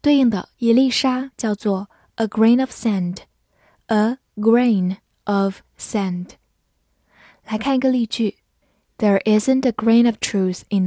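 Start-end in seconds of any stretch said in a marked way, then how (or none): 4.4-6.3 slow, separated words; slow repetition. Shown as a demonstration. Teacher repeats the new phrase for students, slow repetition. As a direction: say each word on its own for learners.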